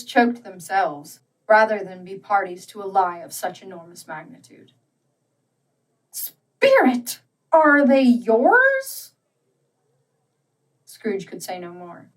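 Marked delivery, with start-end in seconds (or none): 6.1-9.1 high, slow, stricken question; appalled plea. A narrator's reading. Appalled Scrooge asking the spirit if the children are his. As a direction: ask it as if you dread the answer.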